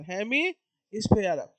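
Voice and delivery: Hemisphere stretched very slowly, syllable by syllable; drawn-out term. Shown as a demonstration. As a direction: draw out hemisphere so the class can copy it.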